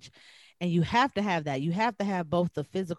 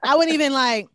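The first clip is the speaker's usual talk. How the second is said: usual speaking voice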